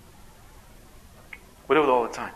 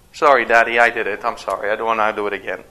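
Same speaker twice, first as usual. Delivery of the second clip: put-on child apologizing; 4 semitones lower, faster than usual. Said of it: "Something like that".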